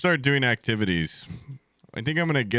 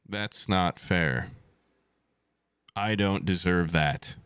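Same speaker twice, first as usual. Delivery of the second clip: low, slow and resigned; deflated, low-pitched lament; dejected complaint; 5 semitones lower, slower than usual. Real, not acted. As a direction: sink down into slow self-pity. Repeats complaint, sinking down.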